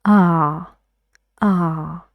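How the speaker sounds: teaching demo of ah. Shown as a demonstration. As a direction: open ah, tongue back up. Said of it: "Can you feel the back of your tongue".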